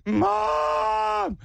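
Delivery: put-on a scared kid sobbing out for his mom; crying shout. Kid fallen off the playground wails for mom, crying shout. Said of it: "'cause he's like".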